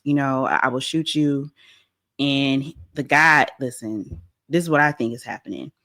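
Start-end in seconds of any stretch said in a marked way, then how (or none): none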